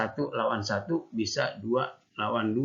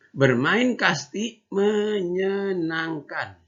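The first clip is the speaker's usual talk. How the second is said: slow, higher storybook reading; reading to children; 8 semitones higher, 4 dB louder, much slower than usual. Shown as a demonstration. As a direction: read each word clearly for little kids. Teacher reads story to class.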